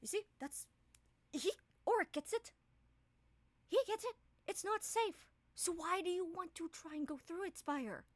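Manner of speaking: scared companion seizing on an ally; relieved Ravine. Acted.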